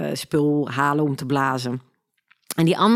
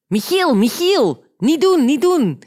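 much higher, louder and slow, begging him to stop; pleading policewoman; 10 semitones higher, 5 dB louder, slower than usual. Retold or imitated. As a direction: imitate the officer pleading urgently. (smekende agente, hoog en luid)

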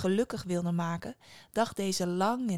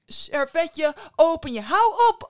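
higher, louder furious shouting; angry yell; 9 semitones higher, 11 dB louder than usual. Acted. Mother yells at Antoine in rising anger. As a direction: let the rage boil over.

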